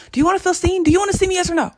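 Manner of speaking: loud, high-pitched insistent questioning; mock demanding. Mock-pressing viewers to comment.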